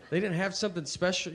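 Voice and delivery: ordinary delivery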